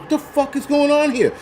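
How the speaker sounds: a baffled cry of disbelief; retold disbelief. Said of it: "I'm like".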